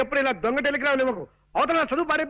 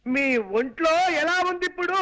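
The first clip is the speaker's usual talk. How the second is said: shouting to be heard; 4 semitones higher than usual. Acted. Son-in-law repeating his question to deaf aunt, shouting. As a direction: project every word to a deaf listener. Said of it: "అంత గట్టిగా అరవకు నాయనా".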